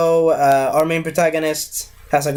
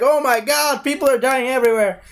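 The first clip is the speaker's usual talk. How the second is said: high, fast panicked cry, lightly played; mock panic; 8 semitones higher than usual. Han Solo on the battlefield, seeing people die, in mock panic. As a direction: gasp it out as if bullets fly overhead.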